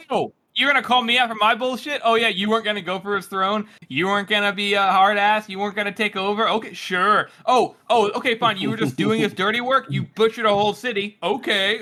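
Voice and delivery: high-pitched, indignant mock retort; imitating someone throwing an accusation back, pitched well up; sarcastic clap-back. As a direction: clap back with mocking, incredulous questions. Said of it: "claps back here".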